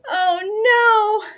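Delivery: high, loud cry of despair; yelled oh no. A narrator's reading. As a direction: wail it out in alarm. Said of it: "yelled her mother".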